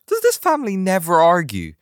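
high, loud imitation of baffled readers; mock incredulous. Quoting readers' amazed question.

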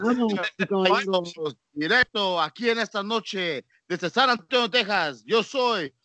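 high, loud ring announcer, stretching the name; lucha libre announcer. Pretend. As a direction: draw out Rodríguez like a ring call. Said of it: "a little extra umph".

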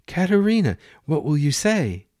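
bright and high, bursting with joy; can't contain her delight; beaming with happiness. Acted. Celia urging her mother to answer, excitedly happy. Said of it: "Celia beamed with happiness".

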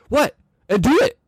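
high, challenging African-accent impression; daring someone in a mock African accent; imitated African accent. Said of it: "he, like, with this accent".

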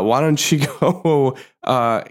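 ordinary talk, nothing marked

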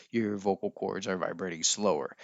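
low voice. Shown as a demonstration. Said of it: "If you're talking in a lower voice".

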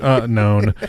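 usual speaking voice

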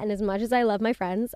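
ordinary talk

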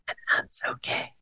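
soft, hushed whisper; whispered reassurance. Real, not acted. Podcaster reassuring listeners about failing, whispered.

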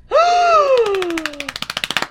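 big high theatrical gasp; dramatic gasp. Pretend. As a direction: gasp like a soap opera. Dramatic reveal.

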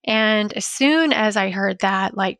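ordinary talk, nothing marked

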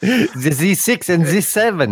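put-on slow, high German accent; hammy German villain saying Z; movie villain German accent. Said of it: "it just sounds like I'm doing a bad German accent".

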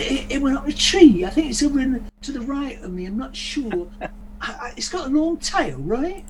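high-pitched English dialect; English accent. Acted. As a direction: put on a high, hesitant English voice. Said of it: "if I can do an English dialect".

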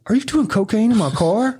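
high-pitched disbelieving question; retold incredulous squeal. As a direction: ask it high, scandalized.

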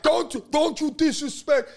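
mock stern dad. Dad scolding his son over the solar deal, mock stern voice. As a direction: scold like an offended father. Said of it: "my dad's like".

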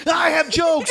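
hammed-up high comic impression; mock whiny comic. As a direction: whine it like a pleading comedian. Mocking a fellow comic.

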